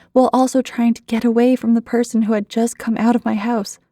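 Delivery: plain reading voice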